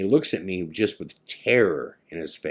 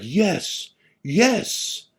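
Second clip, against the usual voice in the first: higher-pitched elated yes; super pumped; 11 semitones higher than usual. Retold or imitated. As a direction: imitate a friend's thrilled relief. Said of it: "he's super pumped".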